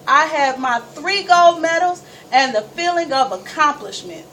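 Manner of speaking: joyful victory boast; triumphant. Retold or imitated. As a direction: let the win burst out, beaming. Relay victory, world record.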